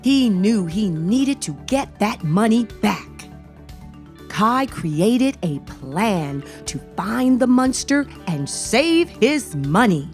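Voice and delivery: plain reading voice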